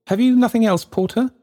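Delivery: put-on high, haughty old gentleman; pompous gentleman. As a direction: look down on the porter. Pompous Sir Joseph.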